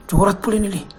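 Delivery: plain reading voice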